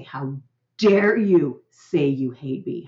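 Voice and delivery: slow, stressed on dare, a mild scold; a parent taking the bait, lightly scolding; mock angry parent. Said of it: "we could turn around and say".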